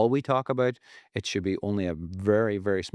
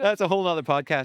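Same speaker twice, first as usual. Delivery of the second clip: amused, high-pitched echo through a laugh; 9 semitones higher, 4 dB louder than usual. Real, not acted. Echoing co-host's joke about the struggle, laughing.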